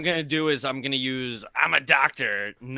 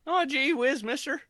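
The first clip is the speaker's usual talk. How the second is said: high, chirpy gee-whiz voice; wholesome 1950s kid; 3 semitones higher than usual. Acted. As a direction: sound like an eager old-timey kid. Player voices nerd character.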